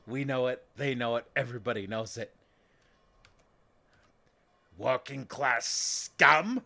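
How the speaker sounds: loud, hammed-up snobbish contempt; class disdain. Engineer class about the peasants, loud disdain. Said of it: "really bring out the, the disdain".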